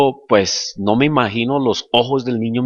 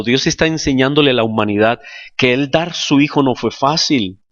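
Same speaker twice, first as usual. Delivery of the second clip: normal talking voice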